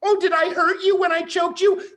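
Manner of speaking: high, fast whiny voice; mock timid man. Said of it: "the fucking men who are like".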